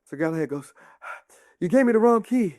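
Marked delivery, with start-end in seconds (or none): 1.6-2.6 higher, emphatic mock complaint; aggrieved knight. Sir Galahad having chased down the king, high aggrieved cry. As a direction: deliver an exasperated punchline as the knight.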